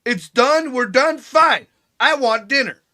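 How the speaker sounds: high, loud, emphatic self-quote; retold firm outburst. Retelling his words ending a fight.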